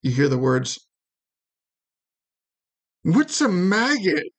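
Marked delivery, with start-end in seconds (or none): 3.0-4.3 higher, slow sneering guard jeer; taunting guard. Acted. Guard mocking someone out of sight with a higher taunt. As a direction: drawl out the insult like a bully guard. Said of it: "one of the guards saying to somebody".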